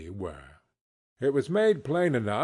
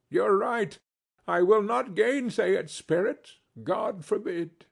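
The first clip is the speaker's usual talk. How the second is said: put-on raised, earnest old Scrooge; Scrooge, eager; 6 semitones higher than usual. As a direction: press the point, a touch high. Scrooge voiced in story.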